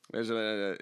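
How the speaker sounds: ordinary talk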